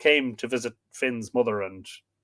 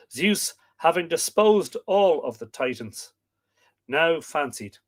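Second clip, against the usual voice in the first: story narration; 3 semitones higher, 4 dB louder than usual. A narrator's reading. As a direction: read the myth out to listeners. Reads myth narration aloud.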